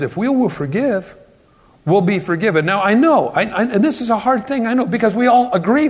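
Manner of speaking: ordinary talk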